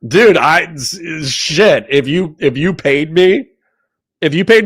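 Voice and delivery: laughing. Real, not acted. Laughing, loud excitement.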